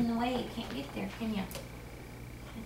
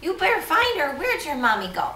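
ordinary talk

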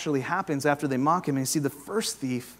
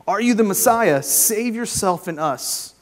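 higher, louder jeer slowing on the second line; reading a mocking insult; 6 semitones higher, 7 dB louder than usual. Preacher reading the criminal's insult at Jesus, loud and mocking. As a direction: hurl the taunt from the cross. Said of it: "hurled insults at him".

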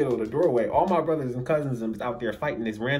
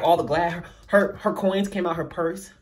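normal talking voice

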